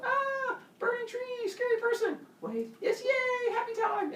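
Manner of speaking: very high silly caveman voice; voicing cavemen scared then thrilled by fire; squeaky cavemen. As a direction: squeal like a simple cartoon caveman.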